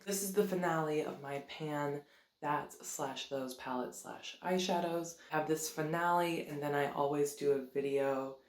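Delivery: ordinary delivery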